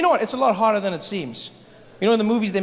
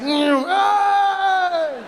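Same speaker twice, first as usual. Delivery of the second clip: high, slow, stretched New Yorker yelling; New York accent shout; 8 semitones higher, much slower than usual. Retold or imitated. Comic mocking a New Yorker yelling at a taxi, in a high stretched shout. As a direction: ham up a brash New Yorker yelling at traffic. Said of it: "You're just like".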